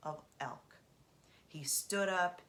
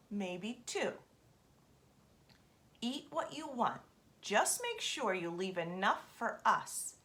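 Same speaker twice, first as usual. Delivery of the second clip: a hint of a bargaining raven, higher; the bird laying out a deal, a touch raised; lightly played crafty raven; lightly voiced raven; 5 semitones higher, 3 dB louder than usual. A narrator's reading.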